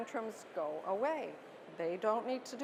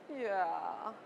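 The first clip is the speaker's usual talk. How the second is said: put-on sulky toddler; 2 semitones lower than usual. Shown as a demonstration. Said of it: "angry, crying three-year-old".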